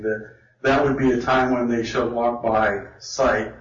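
ordinary delivery

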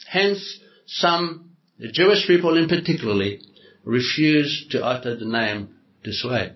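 ordinary talk, nothing marked

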